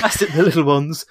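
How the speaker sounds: soft, fussy Yorkshire voice; a quiet Alan Bennett take; Alan Bennett impression. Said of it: "sound more like Alan Bennett".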